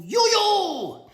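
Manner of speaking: very high, loud echoing you, you; silly high-pitched birthday echo; squeaky echo. Pretend. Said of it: "Oh, now you say it".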